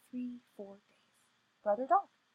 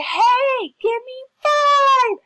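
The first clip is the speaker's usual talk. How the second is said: put-on high, loud, drawn-out kids' taunt; taunting shout; 15 dB louder, much slower than usual. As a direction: holler it mockingly, stretching the words. Children taunting the girl.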